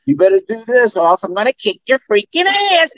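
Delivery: high squeaky mock threat; goofy character threat, performed for fans; signature catchphrase. Shown as a demonstration. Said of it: "they want me to say".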